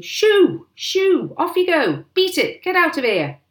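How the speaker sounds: very high, loud shooing cries; full-voiced driving shouts; shouting. A narrator's reading. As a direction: yell to scare birds away.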